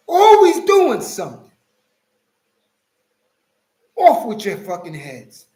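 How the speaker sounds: imitating his outburst, high and loud; retold yelling. Retelling how he yelled at the AI video, mock fury. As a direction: blow up at the screen all over again. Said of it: "I was yelling at the computer".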